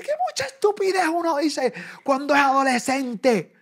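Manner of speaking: high, loud, slow exasperation; imitating wife's outburst. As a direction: drag it out like the fed-up wife. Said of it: "porque dice". (imitando a la esposa exasperada)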